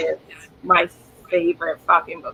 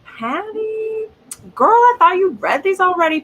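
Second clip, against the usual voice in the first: playful teasing call-out; 10 semitones higher than usual. Real, not acted. Teasing a friend on stream.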